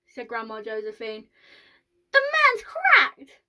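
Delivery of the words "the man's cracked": very high, slow, loud screech of protest; shrieking, scandalised granny; outraged old woman. A narrator's reading. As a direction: squawk it in indignation. Grandmother protests about Mister Wonka.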